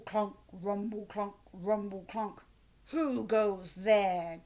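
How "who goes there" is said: louder, slow angry troll demand; a troll challenging a stranger on his bridge; angry troll grumble. A narrator's reading. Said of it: "grumbled the angry troll".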